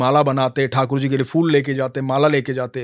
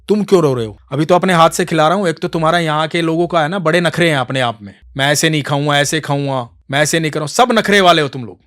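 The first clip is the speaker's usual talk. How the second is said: louder, grumbling rebuke; angry scolding; 3 semitones higher, 8 dB louder than usual. Acted. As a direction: scold the fussy child. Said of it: "गुस्सा तो थे ही वो".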